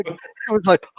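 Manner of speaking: usual speaking voice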